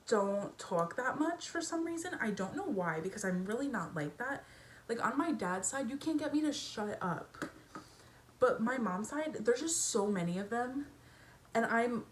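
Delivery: usual speaking voice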